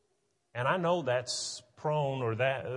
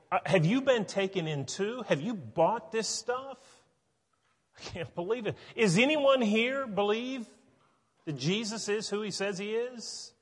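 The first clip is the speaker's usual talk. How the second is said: much higher, disbelieving outburst; incredulous Pharisees; 8 semitones higher than usual. Retold or imitated. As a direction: act out the council's shocked scorn.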